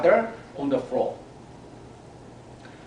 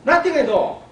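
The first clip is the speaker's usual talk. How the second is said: reassuring mom; 12 semitones higher, 8 dB louder, faster than usual. A narrator's reading.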